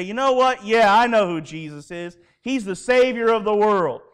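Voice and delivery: bold proclamation. Pretend. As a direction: proclaim it as if standing tall. Imagined bold confession by Peter.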